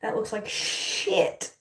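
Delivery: breathy whisper under her breath, slow; slow disgusted whisper at the mirror. Real, not acted. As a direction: mutter it to yourself in disgust. Muttering at bad hairstyle.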